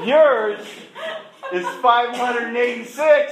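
loud, high, laughing as he quotes; laughing through it. Real, not acted. Speaker to audience, retelling doctor's number while laughing. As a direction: gets the words out through laughter.

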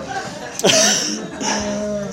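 high breathy laugh at himself; a burst of self-mocking laughter; real laugh. Real, not acted. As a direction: laugh at how he looked. Laughs at his own story.

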